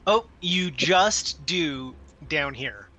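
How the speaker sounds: ordinary delivery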